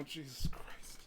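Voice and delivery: breathy whispered exasperation; whispered aside. Acted. Loop reacting to Siffrin's face, whispered exasperation. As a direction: mutter it under your breath.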